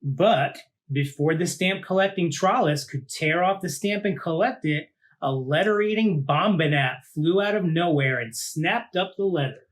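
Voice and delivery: put-on lively read-aloud for children; story narration. A narrator's reading. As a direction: tell it like a picture book to a class. Reads picture book aloud.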